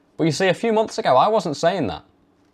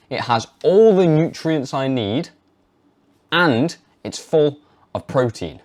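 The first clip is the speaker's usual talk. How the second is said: slow, firm and deliberate; with conviction; 2 semitones higher, slower than usual. Shown as a demonstration. Showing how to pitch raw milk to parents, slow conviction. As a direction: state it like proven fact. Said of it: "Say it like that".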